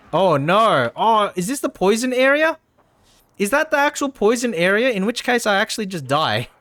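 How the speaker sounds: realizing you've walked into danger; alarmed surprise. Real, not acted. Player spotting the poison area, alarmed surprise.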